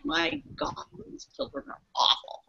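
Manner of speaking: hushed, breathy muttered aside under the breath; whispered aside. Retold or imitated. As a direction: mutter it so the mother cannot hear. Inner thought muttered at kids.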